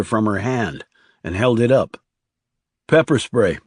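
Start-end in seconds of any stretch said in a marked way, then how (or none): 2.9-3.6 confirming tone. A narrator's reading. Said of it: "he said in a confirming tone".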